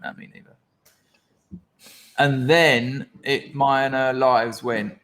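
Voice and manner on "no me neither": barely voiced agreement; whispered quote. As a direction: whisper "No, me neither" much softer. Quoting his own reply.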